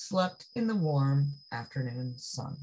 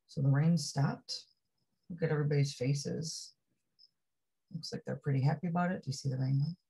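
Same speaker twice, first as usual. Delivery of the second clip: usual speaking voice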